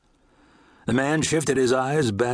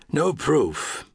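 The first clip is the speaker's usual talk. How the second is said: short, matter-of-fact dismissal with higher pitch; flatly stated; 6 semitones higher than usual. A narrator's reading. Ranger answering an accusation, flatly. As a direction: state "No proof" as settled fact, falling at the end. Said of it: "he said flatly".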